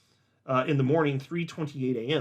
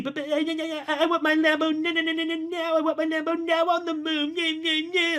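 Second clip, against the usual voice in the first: put-on high, far-off whining toddler; whiny baby voice; 13 semitones higher than usual. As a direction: whine like a spoiled little kid. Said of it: "You just sound like a little whiny baby".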